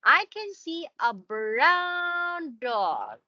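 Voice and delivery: clearly slowed, every word spaced out; slow modelled sentence. Shown as a demonstration. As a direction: say each word slowly for a child to copy. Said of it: "one more time".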